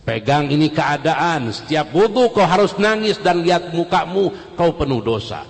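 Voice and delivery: high, loud exhortation to weep at wudhu; fiery preaching. Acted. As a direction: preach it with full force. Preaching at full force.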